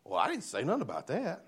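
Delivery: a put-on bewildered voice, clearly not his own; confused Nicodemus. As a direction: Nicodemus thrown off by the answer, confused. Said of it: "confusion that comes across Nicodemus's mind".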